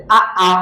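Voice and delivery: আ, আ as two short pushed beats; two separate, abrupt short vowels in আ, আ; jolted vowels. Shown as a demonstration. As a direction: give each আ its own little jolt. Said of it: "একটু ধাক্কা লাগবে, ঝটকা লাগবে".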